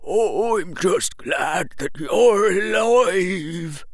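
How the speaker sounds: put-on high, slow, quavering old man; old man's murmur. A narrator's reading. Old man relieved the boy lives, slow and quavering. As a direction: draw it out in an elderly quaver. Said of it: "he murmured".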